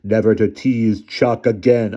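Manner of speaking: slow, loud booming threat. Acted. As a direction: boom it down like a menace. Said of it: "said in a booming voice".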